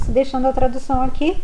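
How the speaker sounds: usual speaking voice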